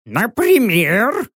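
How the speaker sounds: НАПРИМЕР high and stretched, rising to a question; drawn-out high query; questioning owl. A narrator's reading. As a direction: ask НАПРИМЕР as a suspicious owl. Owl questions the narrator's demand.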